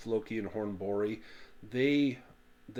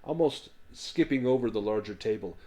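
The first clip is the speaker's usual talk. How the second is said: ordinary delivery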